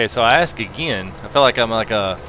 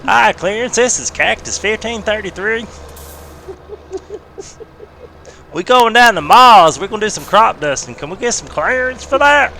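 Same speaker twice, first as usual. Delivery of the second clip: put-on a country boy calling the tower for clearance; 11 semitones higher, 6 dB louder than usual. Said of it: "do that voice to call in your clearance".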